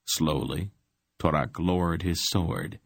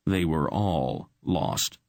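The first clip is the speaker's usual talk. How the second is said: plain reading voice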